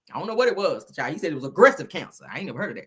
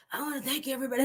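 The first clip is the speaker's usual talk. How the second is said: higher, softer put-on preacher voice; imitated frail preacher; 6 semitones higher, 9 dB quieter, much faster than usual. Host shows how the sick preacher sounded, high soft imitation. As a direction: mimic a weakened man addressing church. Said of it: "That's how he sound".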